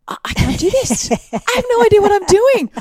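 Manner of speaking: retelling how she finally admitted defeat, mock helpless wail; exasperated giving up. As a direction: play it as a mock meltdown over a bike tire. Retold giving up on tire.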